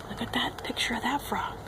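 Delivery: unvoiced hushed whisper; soft secret whisper. Real, not acted. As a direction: whisper it to the listeners. Whispering about picture.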